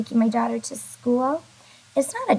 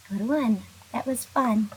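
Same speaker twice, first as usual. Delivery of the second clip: ordinary talk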